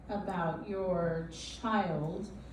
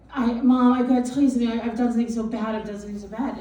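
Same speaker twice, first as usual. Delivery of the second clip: loud, pitched-up panicked confession, imitated; retold crying and screaming; 2 semitones higher, 9 dB louder, much faster than usual. Mother acting out her son's confession, retold frantic cry. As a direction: blurt it out loudly like a frantic kid. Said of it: "him crying and screaming".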